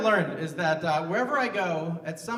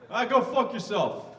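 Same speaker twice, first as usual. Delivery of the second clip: high, slow New Jersey brush-off; New Jersey accent; 6 semitones higher, 4 dB louder, slower than usual. Shown as a demonstration. Comic having the crowd guess accents, New Jersey accent. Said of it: "nobody saying New Jersey".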